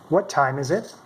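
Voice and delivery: ordinary talk, nothing marked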